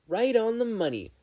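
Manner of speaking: light up as you say it; beaming. A narrator's reading. Girl confirming the boy's guess, beaming. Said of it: "Ochako beamed".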